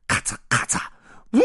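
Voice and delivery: voiceless "ka-cha" clacks then a hoot; crunchy clacks, long woo; switch clacks, whistle. Acted. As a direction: imitate track lever and train whistle. Imitated switch lever and whistle.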